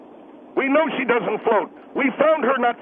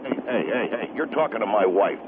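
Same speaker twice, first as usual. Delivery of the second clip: much lower, softer voice of a protective husband; angry husband; 14 semitones lower, 3 dB quieter than usual. Acted. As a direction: step in as the husband, low and threatening. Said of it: "I'm the husband of the lady".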